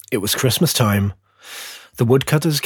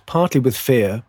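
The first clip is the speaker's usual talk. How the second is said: ordinary delivery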